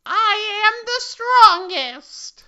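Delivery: high, slow boastful yell; Cirno yelling. Acted. Reading Cirno's boast in the fanfic as a high, slow yell. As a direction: proclaim it like a bratty fairy. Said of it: "yelled Chino".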